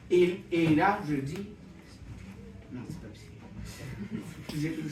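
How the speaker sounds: ordinary talk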